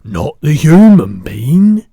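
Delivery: big, slow, booming giant voice; the giant's voice. Acted. Giant correcting the girl, big booming voice.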